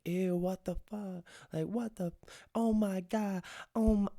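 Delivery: high, slow disgusted squeal, mimicking the girls; grossed-out girls. Sorority girls with puke on their feet, high disgusted squeal. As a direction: squeal like you just got puked on.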